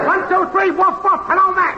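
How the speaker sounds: high-pitched, singsong mic-test chant; woofing mic-test yell. Acted. Technician testing if the show is on air, high woofing yell. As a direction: chant it high, evenly, like barked test words. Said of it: "woof woofing and yelling".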